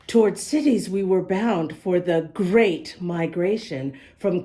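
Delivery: slow, clear storybook reading; reading to children. Acted. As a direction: read the rhymes to little listeners. Reads the picture book aloud.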